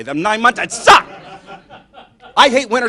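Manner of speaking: loud, punched-out complaint; exasperated rant. Acted. Griping to the crowd about Michigan winters, exasperated. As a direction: build to a yell on the last word.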